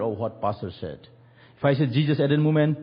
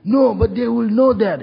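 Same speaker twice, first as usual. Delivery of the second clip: worried insistence; 10 semitones higher, 9 dB louder than usual. Retold or imitated. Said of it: "And he was".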